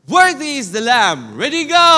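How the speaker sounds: shouted "Worthy is the Lamb"; rally the crowd with a shout; loud voice. Shown as a demonstration. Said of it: "With a loud voice".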